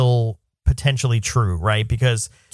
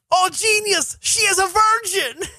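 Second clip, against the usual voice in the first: high, slow put-on old German man; German guy voice; slower than usual. Scary German guy pointing at the child, high mock voice. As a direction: ham up the scary German guy's discovery. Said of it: "the German just goes".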